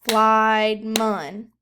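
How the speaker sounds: "Flied mun" loud, one beat per syllable; clapped syllables. Shown as a demonstration. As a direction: clap it out loudly for the class. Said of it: "Clap it".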